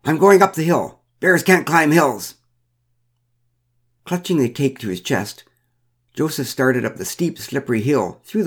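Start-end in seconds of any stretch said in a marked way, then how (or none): 0.0-2.3 raised, high call to friends. A narrator's reading. Man tells his friends his escape plan, calling out. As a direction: call it across a distance. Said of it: "to us he called".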